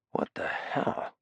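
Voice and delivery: slow, breathless whisper of disbelief; hushed, slow what the hell; stunned whisper. Acted. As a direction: breathe it out slowly in shock. Harry stunned by the liquor boxes.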